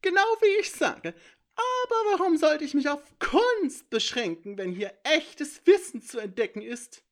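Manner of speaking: high, loud, gleeful creature voice; a smug little thief lecturing from the shadows; high sly intruder voice. A narrator's reading. Sneaky intruder boasting to the heroes, high and gleeful.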